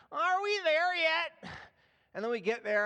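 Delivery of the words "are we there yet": put-on high, slow, drawn-out child's whine; whiny kid. Son complaining on the drive to grandma's, high slow whine. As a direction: whine like a bored kid in the back seat. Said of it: "he complains".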